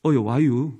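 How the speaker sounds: understated Chungcheong welcome; Chungcheong dialect. Shown as a demonstration. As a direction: say it low like an unhurried Chungcheong local. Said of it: "우리 충청도는".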